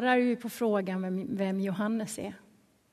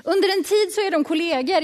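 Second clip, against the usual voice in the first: ordinary delivery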